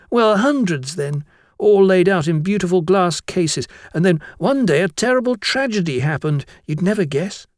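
put-on high-pitched boy spinning a tale; chattering schoolboy. Blotwell tells of a terrible tragedy, high schoolboy voice. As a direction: build up the tragedy as an eager pupil.

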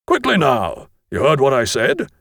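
mildly impatient teacher voice; impatient teacher's order. A narrator's reading. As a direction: hurry the dawdling boys along. Teacher hurries boys who linger.

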